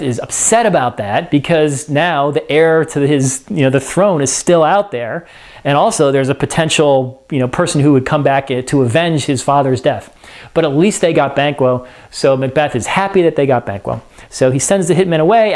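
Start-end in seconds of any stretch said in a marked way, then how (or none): none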